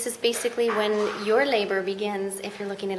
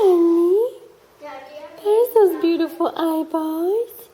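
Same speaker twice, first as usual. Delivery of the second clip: high, slow, cooing voice; 7 semitones higher, much slower than usual. Real, not acted. Cooing to the new baby at home.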